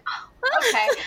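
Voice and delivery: high, deflated oh okay; mock surprised letdown. Hearing she's not in labor, a high surprised letdown. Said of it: "I was like".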